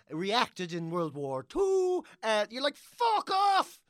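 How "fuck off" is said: high, loud fed-up snap; mock outburst. Fed up diner snapping at the steak storyteller, mock yell. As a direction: tell the waiter off with a sudden yell.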